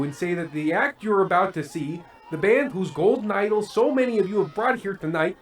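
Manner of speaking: building tirade. Acted. Host denouncing the band to the crowd, building to a shouting rant. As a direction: climb into a furious sermon against the band.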